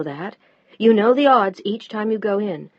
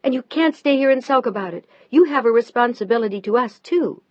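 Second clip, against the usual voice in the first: louder, higher, pressing shout; 5 semitones higher, 6 dB louder than usual. A narrator's reading. Wife shouting that he owes his family. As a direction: demand he face his family. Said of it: "Now Mel was shouting, too".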